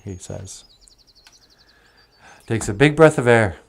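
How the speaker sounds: plain reading voice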